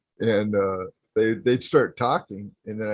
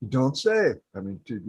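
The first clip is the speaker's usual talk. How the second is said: ordinary talk, nothing marked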